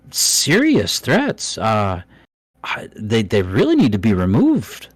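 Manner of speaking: a worried little dwarf stammering out warnings; nervous dwarf NPC. Acted. DM voices dwarf Daslin.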